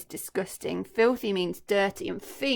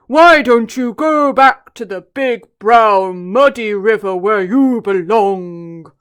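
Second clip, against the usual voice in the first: much louder, very slow booming elephant; big deep voice; 17 dB louder, slower than usual. Acted. Trunky confronting the crocodile, big deep voice. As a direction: boom it like a huge elephant. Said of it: "in his big, deep voice".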